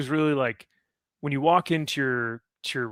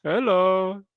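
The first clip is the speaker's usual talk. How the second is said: put-on Indian accent; 6 semitones higher, 4 dB louder than usual. Imitating Indian scam call agent.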